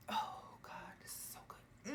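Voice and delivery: breathy whispered delight. Real, not acted. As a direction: whisper it like a secret pleasure. Savouring the scent.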